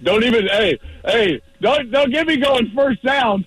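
high, excited play call at the line; hyped quarterback call. Acted. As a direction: fire up the huddle, laughing through it. Acting QB at the line.

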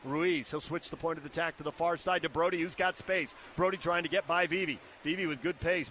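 ordinary talk

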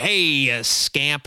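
slow, drawn-out teasing "hey, you scamp"; lightly voiced fond father; playful dad voice. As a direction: ruffle a kid's hair and tease him lightly. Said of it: "I'm like".

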